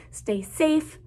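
normal talking voice

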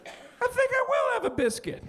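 very high, small voice; dainty decision. Acted. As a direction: float it up high, as a sudden thought. Acting out sudden greedy thought.

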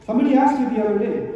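ordinary delivery

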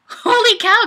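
high, loud exclamation; amazed. Real, not acted. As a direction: blurt it out in surprise. Amazed at flood of comments.

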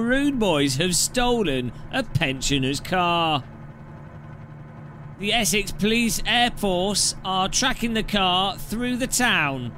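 mock crime-show announcer; TV police show narrator. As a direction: narrate a police chase documentary, drawn out.

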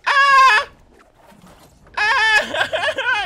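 high, loud scream breaking into laughter; panicked shriek. Real, not acted. Under fire in the game, shrieking and laughing. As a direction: shriek in fright then laugh.

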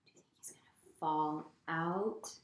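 ordinary delivery, nothing marked

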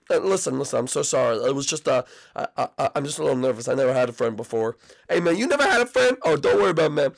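ordinary talk, nothing marked

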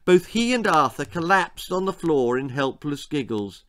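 plain reading voice

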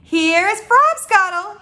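loud, high, slow announcement; proud cry. Acted. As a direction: present it grandly, drawing out the words. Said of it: "he cried".

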